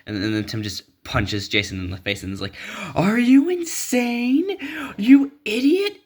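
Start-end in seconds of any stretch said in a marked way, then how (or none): 2.9-6.0 much higher, slow, loud outburst; furious yell. Acted. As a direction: scream it at him in outrage. Tim yells at Jason.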